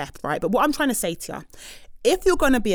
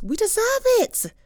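much higher, stress on deserve; emphatic declaration; 13 semitones higher than usual. Real, not acted. Host telling listeners they've earned summer, high emphatic cry. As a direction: proclaim it like a rallying cry.

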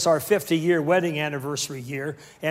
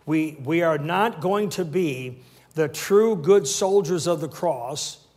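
ordinary talk, nothing marked

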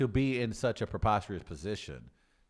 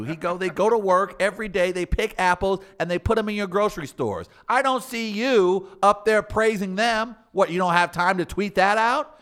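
loud, high scolding of the press; righteous spokesman rant; indignant defense; 6 semitones higher, 5 dB louder than usual. Acted. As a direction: lecture the reporters with mock outrage.

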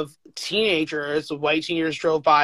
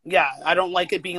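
normal talking voice

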